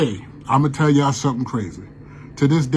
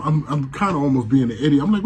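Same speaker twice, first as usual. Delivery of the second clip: ordinary delivery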